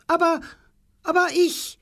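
very high, flustered stammering aber; shrill protest. Acted. Frau Tingel protesting about her letter to her friend, very high. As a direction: squeak out the protest, scandalised. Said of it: "schrie Frau Tingel".

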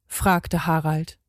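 ordinary delivery, nothing marked

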